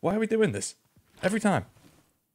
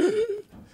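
sudden high gasp for air; sharp gasp. Retold or imitated. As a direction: gulp in a big breath. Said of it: "And I was like".

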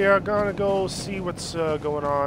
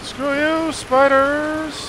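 defiant yell; 9 semitones higher, 5 dB louder, much slower than usual. Real, not acted. Fleeing the spiders.

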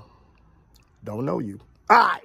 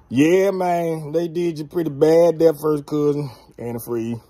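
normal talking voice

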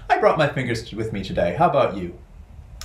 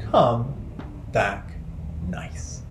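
fading whisper; much slower than usual. Shown as a demonstration. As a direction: fade down to a whisper. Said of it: "nice bird, fading whisper".

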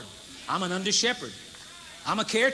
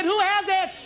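louder repeat; 9 semitones higher, slower than usual. Real, not acted. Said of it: "I said".

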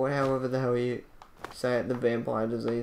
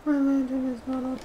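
much higher, put-on squeaky repeat; high silly voice; 10 semitones higher than usual. Player noticing the lantern is off, silly high voice.

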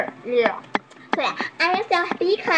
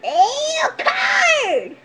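high-pitched, playful shriek of disgust; disgusted squeal; 10 semitones higher than usual. Pretend. Reacting to a card from the mouth, high squeal. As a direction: squeal it high at a gross surprise.

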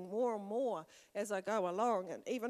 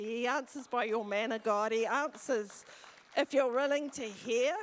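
louder, rising preacher's call; preaching at full force; 2 semitones higher, 7 dB louder than usual. Real, not acted. Preacher rousing the congregation, loud and rising. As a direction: build it up and drive it home.

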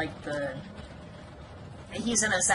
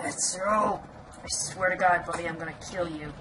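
normal talking voice

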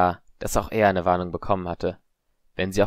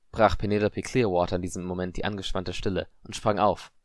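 ordinary delivery, nothing marked

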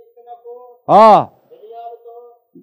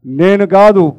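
ordinary talk

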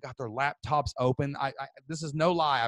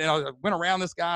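usual speaking voice

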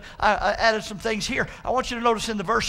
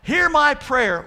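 high, loud, drawn-out plea; crying out to God; 6 semitones higher, 6 dB louder, much slower than usual. Acted. Preacher as psalmist asking God to hear, crying out. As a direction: cry it out slowly, stretching the words. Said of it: "He's crying out to the God".

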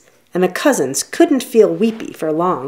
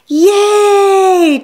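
high, loud cheering yay; joyful cheer; 13 semitones higher, 5 dB louder than usual. Acted. Cousins cheering at a summer-long stay, high loud yay. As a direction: burst out cheering like excited kids. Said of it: "cheered the cousins".

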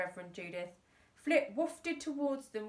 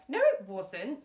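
snapping; 7 dB louder than usual. Acted. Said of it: "snapped Flip".

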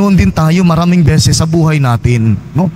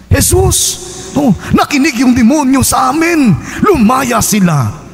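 excited disciples shouting; 8 semitones higher than usual. Acted. Disciples shouting their joy to Jesus, excited. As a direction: burst out like overjoyed disciples.

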